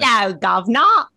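a put-on London street accent, hammed up; bad cockney. Cohost tries out a bad British cockney accent. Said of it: "intentionally bad British accents".

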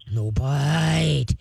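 very slow, low "ah, no bite" dragged out; low slow command. Shown as a demonstration. Trainer demonstrating the deep correction voice for the owner. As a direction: stretch "ah" long, then "no bite" low and firm. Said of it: "The lower and slower and deeper your voice is".

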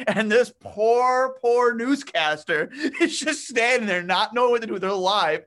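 barely holding the laugh in while telling it; laughing disbelief. Real, not acted. Telling the Bruce Smith blooper to Kelly, laughing in disbelief.

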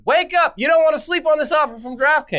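hammed-up urgent promo shout; mock wake-up call. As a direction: call out to rouse a sleeper, playfully. Waking the sleeping uncle in ad.

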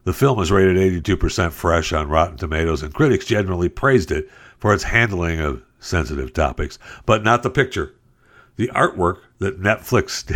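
usual speaking voice

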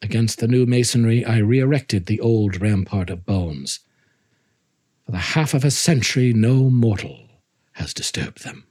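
story narration. Acted. Reading Poe story aloud.

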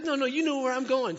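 high, loud, brisk reassurance; acted emphatic contradiction; insistent correction. Acted Jesus insisting to doubting Thomas.

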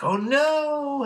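very high cartoon wail; hammed-up cry of horror; mock dismay. Beast Boy sees the other Teen Titans, mock horror.

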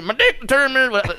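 hammed-up, high-pitched imitation; Cosby. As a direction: push the impression up high. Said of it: "said that kinda like Cosby".

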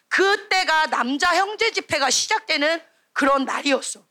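ordinary delivery

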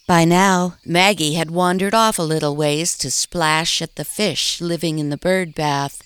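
ordinary delivery, nothing marked

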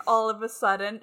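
ordinary talk, nothing marked

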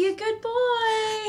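put-on high, slow cooing baby talk; baby talk to a dog. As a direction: coo at the dog like a toddler. Talking to the dog Max.

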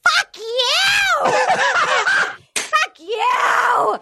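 high screaming tantrum, laughing through it; hammed-up enraged shout at Kenny; mock furious yell. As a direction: shriek it like a scorned reality star. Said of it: "she's like".

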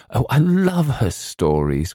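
put-on high, gushing girlish cry; ecstatic. A narrator's reading. Girl adoring the aunt's stories, ecstatic cry. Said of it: "clasping her hands ecstatically".

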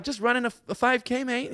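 high, breezy mock-Aussie reply; lighthearted. Imitating tear-gassed protester answering an interviewer, high lighthearted voice. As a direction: toss it off high and cheerful, like nothing is wrong. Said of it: "they keep it lighthearted all the time".

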